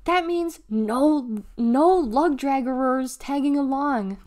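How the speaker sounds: high, slow, drawn-out telling-off; exasperated scolding. A narrator's reading. Deli forbids R B tagging along, slow and scolding. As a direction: stretch the words to lay down the law. Sister scolds tagalong brother.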